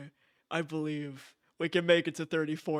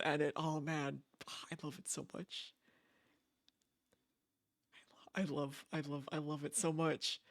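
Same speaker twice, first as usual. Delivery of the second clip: soft, breathless gushing over a loved game; half-whispered adoration, barely above a murmur; hushed gushing; 3 semitones lower, 12 dB quieter than usual. Real, not acted. Streamer to chat about her favourite game, soft and gushing.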